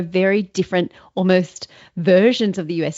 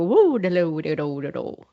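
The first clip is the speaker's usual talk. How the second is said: put-on lyrical Fargo accent; 4 semitones lower than usual. Host marvelling that it hardly sounds real, mimicked Fargo accent. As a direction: mimic only the Fargo tune. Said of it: "almost doesn't sound like a real accent".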